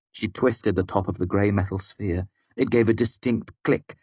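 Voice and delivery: usual speaking voice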